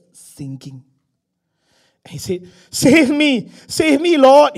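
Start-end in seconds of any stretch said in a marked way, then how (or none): 2.7-4.6 high, very loud cry for help; full-voiced, high-pitched yell for help; shouted plea. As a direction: shout desperately to be rescued. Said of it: "he shouted".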